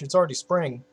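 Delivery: ordinary talk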